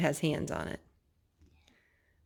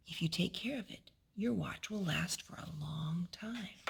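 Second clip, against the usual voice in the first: whisper; 11 dB quieter than usual. Acted. Father's advice read softly.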